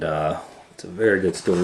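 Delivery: ordinary delivery, nothing marked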